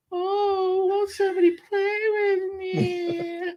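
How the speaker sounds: put-on very high, drawn-out eerie child wail; scary little girl. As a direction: plead slowly like a ghostly little girl. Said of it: "and this little girl".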